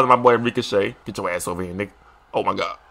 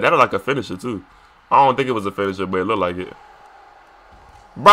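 usual speaking voice

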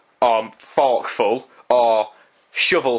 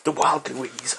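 hushed wildlife narration; soft, slow documentary voice; nature documentary narrator; 4 dB quieter, slower than usual. Retold or imitated. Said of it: "I'll do my David Attenborough".

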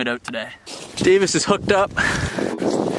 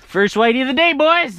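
excited call; 8 semitones higher, 5 dB louder than usual. Real, not acted. First fish, calling to friends.

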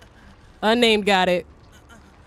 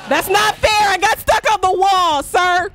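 higher, louder whining protest; indignant yell; 6 semitones higher, 3 dB louder, slower than usual. Real, not acted. Streamer protesting to the killer, loud high whine. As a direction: yell it like the ref missed a foul.

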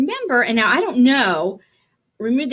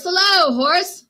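higher, louder sing-song hello; storybook duck hailing the dog; cheery duck greeting; 10 semitones higher, 4 dB louder, slower than usual. Acted. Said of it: "said Duck".

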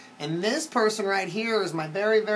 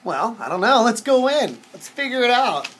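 plain reading voice

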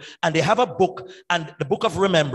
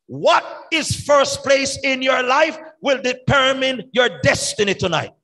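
louder and higher, driving each who; preaching at full force; 6 semitones higher, 3 dB louder than usual. Real, not acted. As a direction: press it home to the crowd. Preacher pressing the point.

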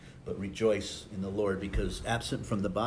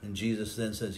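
ordinary talk

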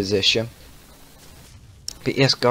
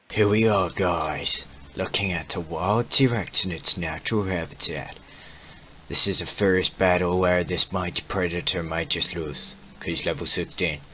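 hushed documentary voice, softer; nature documentary narrator; 4 dB quieter than usual. Pretend. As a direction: narrate like a wildlife film. Nature documentary parody about T-Rex.

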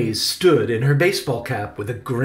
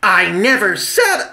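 loud, high indignation; raised-voice flustered outrage; flustered sputter; 8 semitones higher, 8 dB louder than usual. A narrator's reading. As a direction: protest sharply, affronted. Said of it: "Victoria stuttered".

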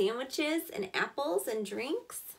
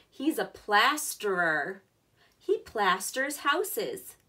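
put-on reading to children; 6 dB louder than usual. Teacher reading a picture book to her class, louder storybook voice. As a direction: read it out like a picture book for little kids.

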